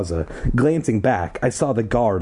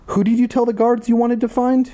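put-on high boy voice; 13 semitones higher than usual. Paul voiced higher.

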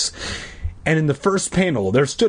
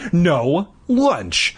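furious shout; 6 semitones higher than usual. Acted. Todd shouting at his sister about lunch, furious. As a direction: snap it back in rage. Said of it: "Todd shouted furiously".

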